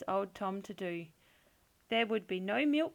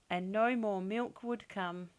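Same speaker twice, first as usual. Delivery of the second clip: plain reading voice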